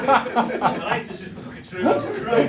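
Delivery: loud and high, laughing through it; can hardly speak for laughing. Real, not acted. Retelling the funeral mishap to the host, laughing.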